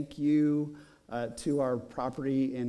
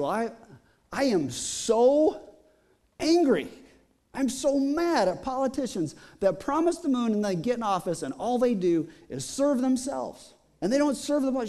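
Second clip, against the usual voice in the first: retold anger; 8 semitones higher than usual. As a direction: let her fury boil over, pitched high. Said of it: "she's kind of angry".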